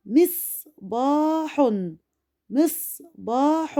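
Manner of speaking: "مصباح" very slow, stopping on the ص; pause on ص. Shown as a demonstration. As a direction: break "مصباح" after "mis", then stretch "baa-hun". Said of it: "وقفت على الصاد". (نطق "مصباح" ببطء مع الوقوف على الصاد)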